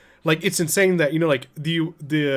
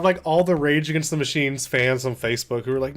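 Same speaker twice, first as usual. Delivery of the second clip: ordinary talk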